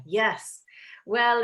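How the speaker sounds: ordinary talk, nothing marked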